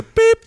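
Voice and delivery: high, loud imitated beep; high-pitched mock censor beep. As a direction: imitate a bleep tone with the voice.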